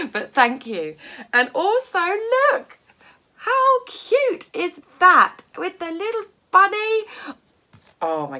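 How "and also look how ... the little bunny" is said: high, loud excited gushing; all excited. Real, not acted. Showing viewers the cute bunny card, all excited. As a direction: gush loudly over something cute. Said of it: "getting all excited".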